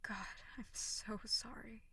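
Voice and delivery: soft remorseful whisper; sultry narrator. Acted. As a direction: whisper the apology tenderly. Whispered apology to crying listener.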